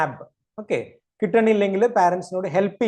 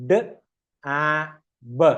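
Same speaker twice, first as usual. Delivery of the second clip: slow sounding-out; much slower than usual. Shown as a demonstration. Teacher starts reading the next word, slowly spaced sounds. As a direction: separate sounds with clear gaps. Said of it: "വായിച്ചു നോക്കിയേ".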